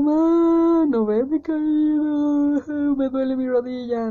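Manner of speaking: put-on high, loud, whining little kid who fell; child calling mom after a fall, tearful whine; crying child. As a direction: wail for mommy like a hurt toddler. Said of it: "y está así llorando".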